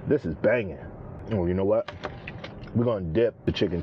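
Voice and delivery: chewing between words; talking while eating soup. Real, not acted. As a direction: mouth partly full of food. Seen 1: Holds soup bowl and spoon throughout; took a spoonful just before.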